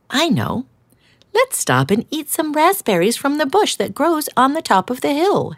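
timid hedgehog offering an idea; gentle hedgehog. Acted. Hedgehog suggests.

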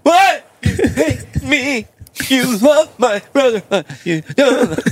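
high, hammed-up bawling; mock crying. Wronged child accusing parent, mock wailing. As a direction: drag the words out through fake sobs. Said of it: "Just stop crying".

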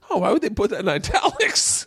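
high-pitched, laughing mock puzzlement; mock bafflement. Comic retelling jeans joke to host, mock bafflement. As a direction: act out being baffled, pitch jumping up. Acts out own baffled reaction.

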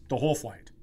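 usual speaking voice

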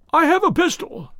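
high, frightened warning; thin quavering threat from a scared man; reedy, quivering voice. A narrator's reading. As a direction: call it out high and jittery. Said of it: "came a reedy, quivering voice".

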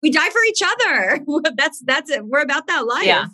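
high giddy voice still laughing. Real, not acted. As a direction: keep riding the laugh, way up high. Giddy banter between two Leos.

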